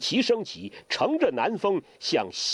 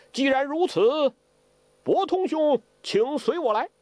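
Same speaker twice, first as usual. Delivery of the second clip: high-voiced scheming host; high, measured invitation; Ouyang Feng; 12 semitones higher than usual. Ouyang Feng asks Zhou Botong to follow him, high and measured.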